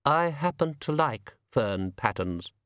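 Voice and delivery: cool, slow and distant; frosty, measured reply; coldly. A narrator's reading. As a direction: say it with a chill, unhurried.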